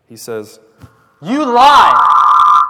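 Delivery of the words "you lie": high, full-out scream; screamed accusation. Acted. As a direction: scream it as a grief-stricken boy refusing bad news. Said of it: "I don't wanna be too loud".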